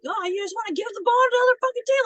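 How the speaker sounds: high, loud, fast mock scream; imitated screaming. Coach berating a player over a bad throw, mock screaming. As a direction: screech it as a rapid-fire telling-off, put on. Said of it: "like, screaming at her".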